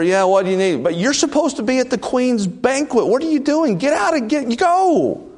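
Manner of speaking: high, rushed voice at the door; high frantic servant voice; hurried messenger. Retold or imitated. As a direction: shoo him out, urgent.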